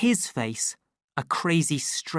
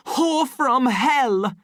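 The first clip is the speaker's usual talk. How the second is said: raging curse; 11 semitones higher, 7 dB louder, much slower than usual. A narrator's reading. Savage shaking Lenina in rage.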